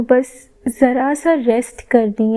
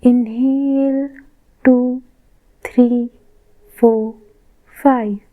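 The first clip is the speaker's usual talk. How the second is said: slow, drawn-out inhale and exhale cues; paced breath count. Shown as a demonstration. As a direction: count the breaths evenly, a pause after each word. (साँसों की धीमी, लयबद्ध गिनती)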